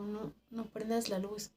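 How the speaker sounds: soft, subdued plea; hushed refusal. Retold or imitated. Worker asking coworker not to light up, hushed.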